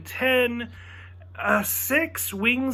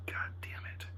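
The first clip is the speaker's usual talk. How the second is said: whispered God damn it; muttered curse; 19 dB quieter than usual. Retold or imitated. Conceding to his friend, a whispered curse. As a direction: whisper it in defeat.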